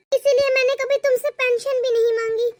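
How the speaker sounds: ordinary delivery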